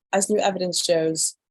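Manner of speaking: ordinary delivery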